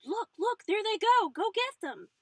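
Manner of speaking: excited high shrieks; screaming. A narrator's reading. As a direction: scream and point out the window. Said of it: "pointed out the window and screamed".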